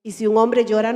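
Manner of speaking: ordinary talk, nothing marked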